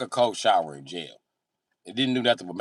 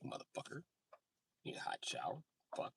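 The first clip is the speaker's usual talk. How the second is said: whispered aside; 7 semitones higher, 20 dB quieter than usual. Retold or imitated. Comedian muttering the aside in a whisper. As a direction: whisper it under your breath.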